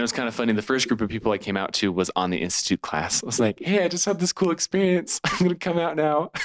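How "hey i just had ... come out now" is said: high, bubbly and laughing; giddy excitement. Retold or imitated. Retelling announcing to the class.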